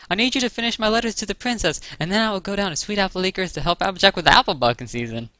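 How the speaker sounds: high-pitched rush of orders; brisk instructions. A narrator's reading. Twilight tells the baby dragon her plans, briskly. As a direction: rattle off your plans in a high voice.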